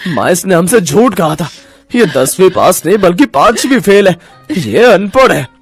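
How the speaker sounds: indignant husband, voice rising; angry accusation. Acted. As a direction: denounce her to your parents. Enraged husband accuses wife to parents. (गुस्से में शिकायत करते हुए)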